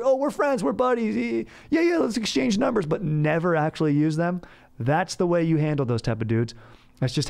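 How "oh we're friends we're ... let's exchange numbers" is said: high-pitched fake-friend voice; hammed-up, much higher pally act; mock chummy. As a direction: gush like an insincere new buddy. Mock bonding with drinking buddy.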